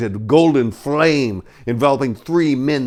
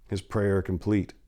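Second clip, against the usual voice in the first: usual speaking voice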